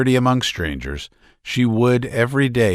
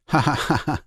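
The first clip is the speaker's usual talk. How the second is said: high, broken mock laughter; hysterical laughing; 6 semitones higher, faster than usual. A narrator's reading. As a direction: laugh as if you can't stop. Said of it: "he laughed".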